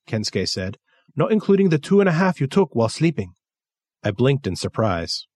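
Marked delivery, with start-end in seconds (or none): 1.2-3.3 put-on much higher, fast Japanese-accented voice. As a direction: rattle it off as Kensuke, high with his accent.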